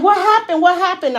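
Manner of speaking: high, loud, alarmed call; rushing in, yelling in alarm; panicked shout. Retold or imitated. Daughter rushing to her screaming mom, panicked shout. Running in after mother's scream.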